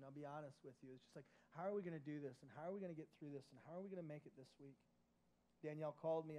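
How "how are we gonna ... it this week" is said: anxious rush. Retold or imitated. The couple's worried inner voice.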